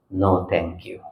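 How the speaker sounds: low, very soft declining; calm refusal. Shown as a demonstration. Teacher telling the mind no, calm refusal. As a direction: decline it gently, almost under the breath. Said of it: "to tell the mind".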